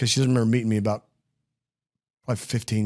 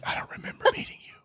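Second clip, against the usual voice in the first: whispered, quick impression of Michelle; whispered imitation; 7 dB quieter, faster than usual. Host whispering Michelle's line to tease her, quick whisper. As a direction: breathe it out fast, teasing her. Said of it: "She's like".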